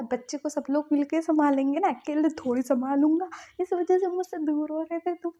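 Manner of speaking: arguing back as the upset spouse; retold heated argument. Wing argues heatedly with husband. (झुँझलाकर ऊँची आवाज़ में बहस)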